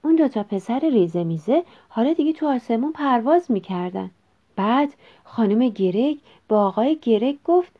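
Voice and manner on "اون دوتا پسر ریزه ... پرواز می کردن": ordinary delivery, nothing marked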